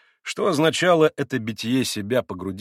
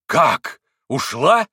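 cried out; 3 semitones higher, 8 dB louder, much slower than usual. A narrator's reading. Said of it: "вскричал Митя".

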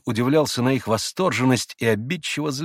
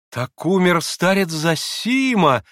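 slow, higher, louder exclamation; exclaiming; 8 semitones higher, 5 dB louder, slower than usual. A narrator's reading. Grushenka exclaims at the elder's death. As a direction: gasp at the news. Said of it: "воскликнула Грушенька".

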